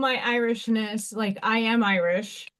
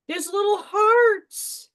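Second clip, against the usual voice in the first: high, slow, near-tearful squeal of delight; excited; 9 semitones higher, 3 dB louder, slower than usual. Real, not acted. Crafter finding heart stickers, overcome with excitement. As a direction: gush over the little hearts, overcome. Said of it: "Sorry, I'm excited".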